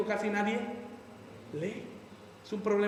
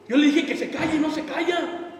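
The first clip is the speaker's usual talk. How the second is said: louder, higher exasperated husband; 6 semitones higher, 5 dB louder than usual. Retold or imitated. Husband complaining to the pastor, exasperated.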